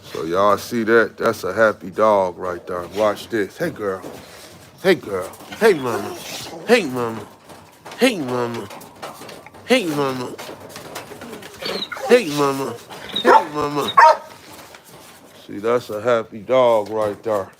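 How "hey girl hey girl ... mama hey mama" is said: dog talk. Real, not acted. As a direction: croon higher and higher to the dog. Cooing to a dog.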